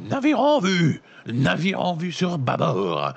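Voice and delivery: high, loud shout from the rigging; lookout's cry. A narrator's reading. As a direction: bellow it down from the crow's nest. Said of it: "Un cri retentit au-dessus de votre tête".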